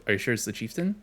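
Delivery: usual speaking voice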